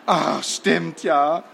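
slow, sighing realisation; relieved. A narrator's reading. Headmaster realises the children are back, relieved. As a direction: let it dawn slowly, with relief. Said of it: "atmete erleichtert auf".

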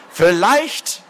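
high, rising incredulous echo; voice cracking outrage. A narrator's reading. Lord mocking the culprit's hedge, voice overturning. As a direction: fling the word back, outraged. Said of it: "die Stimme des Adligen überschlug sich".

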